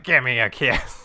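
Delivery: slow, creepy come-on; drawn-out villain kiss request. Retold or imitated. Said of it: "He's like".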